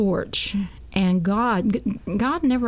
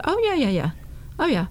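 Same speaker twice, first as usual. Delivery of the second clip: knowing insistence; 7 semitones higher, faster than usual. Retold or imitated. Overrides the dealer's denial.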